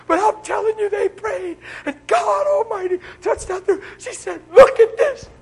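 high, loud, laughing and crying; mock her joyful sob, pitched high; retold weeping testimony. Healed woman showing the church her neck, laughing through tears. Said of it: "She's bawling".

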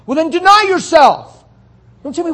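loud, high preaching rebuke; forceful rebuke. Real, not acted. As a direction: hammer it home to the flock.

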